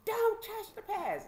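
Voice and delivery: high, slow, drawn-out command; imitated hollered warning. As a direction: warn them off like a mean guard. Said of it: "screaming and hollering at us".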